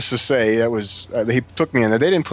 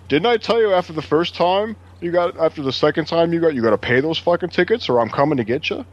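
higher-pitched, lecturing cop; retold cop scolding; 8 semitones higher than usual. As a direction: tell them off like a fed-up cop. Retold cop scolds him over tickets.

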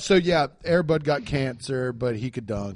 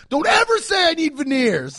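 loud, high scolding; mock outrage; 11 semitones higher, 6 dB louder than usual. Telling off co-host about the veneers remark, mock outrage. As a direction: yell it high in playful outrage.

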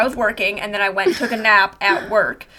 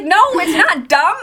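higher, slower mock protest; upset pushback, pitched up and stretched; retold raised voice; 6 semitones higher, 3 dB louder, slower than usual. Arguing back at manager in a dream, voice mildly raised. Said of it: "I raised my voice at her".

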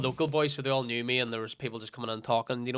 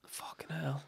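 whispered; 3 semitones higher, 13 dB quieter, much slower than usual. Real, not acted. Whispered reaction to two years.

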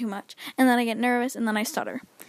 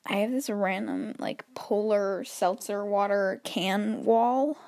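ordinary talk, nothing marked

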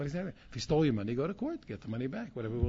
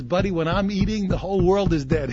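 loud, laughing punchline; 5 semitones higher, 13 dB louder than usual. Retold or imitated.

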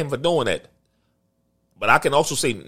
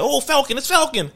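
put-on high, excited little kid spotting a hero; excited child voice; 12 semitones higher than usual. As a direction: squeal it like a thrilled small boy. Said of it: "He like".

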